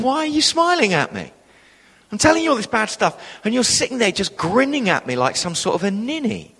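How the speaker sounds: high-pitched, indignant complaint; retold exasperated telling-off; frowning reproach. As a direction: imitate an affronted woman scolding her visitor. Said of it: "she suddenly frowned at me and said".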